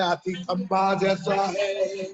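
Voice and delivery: slow, stretched-out storyteller quote; long, lingering final syllables; blind man's verdict. Retold or imitated. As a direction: draw each word out as the blind man declares it. Blind man gives his verdict.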